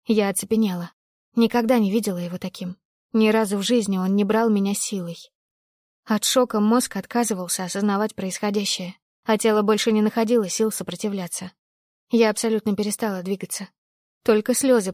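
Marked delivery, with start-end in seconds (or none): none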